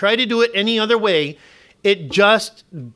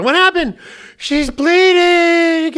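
much higher, louder, alarmed mock wife voice; imitating his worried wife; 11 semitones higher, 5 dB louder, much slower than usual. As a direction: play up the panicked mother, high and drawn out. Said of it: "and she comes in".

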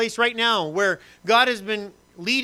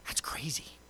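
hushed aside, barely voiced; whisper; 3 semitones lower, 14 dB quieter, slower than usual. Real, not acted.

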